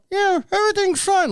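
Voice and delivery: very high, squeaky puppet reply; ventriloquist dummy voice. Acted. Men answer for dead Seamus, high puppet voice. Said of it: "they did the finger to the mouth".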